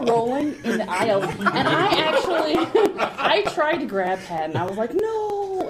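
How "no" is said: high, drawn-out protest; retold panicked no; mock alarmed cry. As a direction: cry out to stop him, playfully. Trying to stop Patton on stage.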